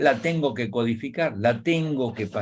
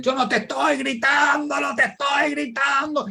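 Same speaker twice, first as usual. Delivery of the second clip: high-pitched, hammed-up yell; shouting in denial. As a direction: play one half of a quarrelling couple, yelling. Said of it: "el que está gritando". (grito agudo de pelea, actuado)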